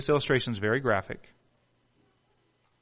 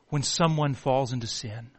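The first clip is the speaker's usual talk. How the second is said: ordinary delivery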